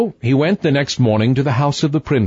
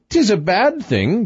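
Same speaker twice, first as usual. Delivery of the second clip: high-pitched queenly contradiction; bickering queen; 10 semitones higher than usual. A narrator's reading.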